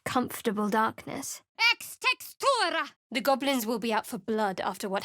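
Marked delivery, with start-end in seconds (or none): 1.7-2.9 high, sharp spell cry; high casting shout; spell incantation. Acted. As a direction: snap the spell into being.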